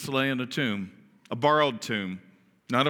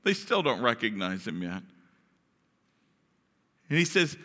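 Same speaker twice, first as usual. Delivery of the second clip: ordinary delivery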